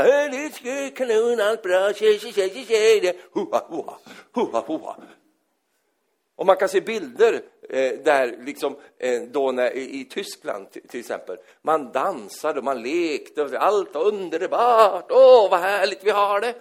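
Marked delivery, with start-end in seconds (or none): 0.0-5.0 high, breezy mock party chatter; carefree partygoers. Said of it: "De bara". 15.1-16.5 high, loud mock-happy sigh; mock delight. Mock carefree people.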